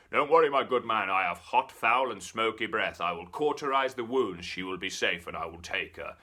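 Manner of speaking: loud, pompous devil voice; lightly voiced smug devil; the devil explaining his hot breath, loud and pompous; grand devil. Devil reassuring the baker.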